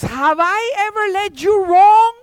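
high, loud donkey voice asking; donkey protesting to Balaam; pleading donkey. Acted. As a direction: appeal to the master hurt and loud. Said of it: "The donkey answers and says".

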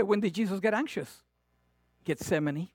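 ordinary talk

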